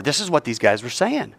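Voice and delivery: ordinary talk, nothing marked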